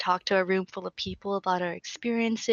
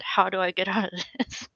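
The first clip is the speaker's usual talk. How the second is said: usual speaking voice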